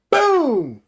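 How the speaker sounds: high, loud boom sound effect; imitated punch sound, yelled; loud mock impact boom. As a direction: shout the kick noise. Sound of kicking.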